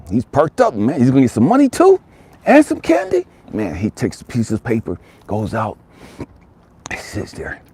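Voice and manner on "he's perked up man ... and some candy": high, loud burst of excitement; excited. A narrator's reading. Narrator sharing the boy's thrill over money and candy, excited. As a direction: lift right up with the boy's joy.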